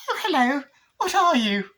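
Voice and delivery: put-on very high, cooing child voice; put-on curious girl, high-pitched; little girl. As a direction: greet a small creature in falsetto. Voices girl meeting small dinosaur.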